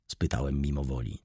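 usual speaking voice

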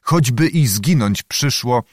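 ordinary delivery

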